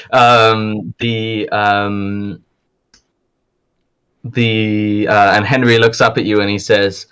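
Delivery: normal talking voice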